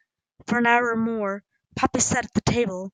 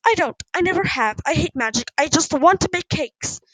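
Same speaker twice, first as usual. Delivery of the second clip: higher-pitched, emphatic protest; upset outburst; 6 semitones higher, 4 dB louder than usual. A narrator's reading. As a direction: confess it in frustrated short bursts. Daughter's tearful outburst at mother.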